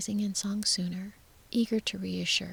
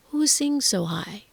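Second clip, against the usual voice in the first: louder, curious question sent upward; wondering aloud; 8 dB louder than usual. A narrator's reading. Unknown voice from the garden asks up to the tower, wondering aloud. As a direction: wonder it aloud to someone above. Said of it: "simply wondering aloud".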